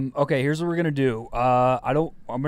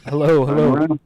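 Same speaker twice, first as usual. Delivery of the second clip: radio host voice; 7 dB louder than usual. Acted. Radio host greeting caller.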